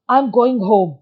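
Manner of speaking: loud, angry reading of the line; furious. Shown as a demonstration. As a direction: read it furiously, like storming off. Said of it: "furiously".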